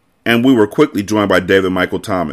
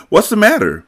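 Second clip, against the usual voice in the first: put-on high girlish question; high-pitched inquiry; 13 semitones higher than usual. A narrator's reading. Girl interrupts the bored babysitter, high-pitched question. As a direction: ask it lightly, pitched well up.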